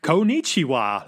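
slow, drawn-out "Konnichiwa"; halting beginner's greeting. Acted. Foreigner trying basic Japanese on locals, slow and laboured. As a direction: spell out each syllable like a beginner.

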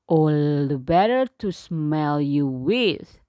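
low, loud wolf voice; a growly-toned beast answering; werewolf. Acted. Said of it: "said the wolf".

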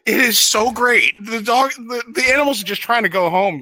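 high excited rant; panicking gamer. Acted. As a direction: defend it with rising passion. Ranting defense of movie.

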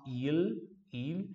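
il with a fully sounded L; Italian L in il. Shown as a demonstration. Teacher drawing attention to one sound, clear L in il. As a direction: hold the L at the end of il. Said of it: "atenção para o som do L aqui".

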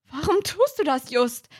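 scared, pleading question; trembling voice. Acted. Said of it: "mit zitternder Stimme". (ängstlich, mit leicht zitternder Stimme)

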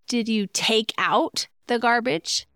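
"take out" set apart with emphasis; stress on TAKE OUT. Shown as a demonstration. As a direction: punch TAKE OUT, then let "the garbage" fall. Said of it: "what you emphasize in your sentence".

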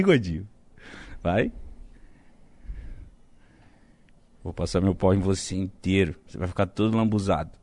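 low, soft mock-menacing taunt; low teasing voice. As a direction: goad him quietly to break. Provoking friend to laugh.

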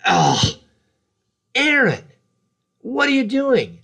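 high, slow, exasperated groan of a wife; wife fed up with husband's bleeding head, exasperated and high. Retold or imitated. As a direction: drag it out, fed up with him.

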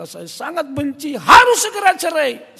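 high, rising to a loud demand; acted tearful fury; distraught outburst. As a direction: imitate a hurt wife bursting out. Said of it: "dia nangis".

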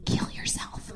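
hushed mock whisper to oneself; barely audible, voiceless aside; self-loathing whisper. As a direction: whisper it under your breath. Muttering to self across the bed.

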